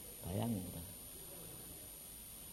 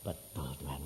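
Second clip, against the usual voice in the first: soft reverent reply; 12 semitones lower, 9 dB quieter than usual. Retold or imitated. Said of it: "Nathanael bilang".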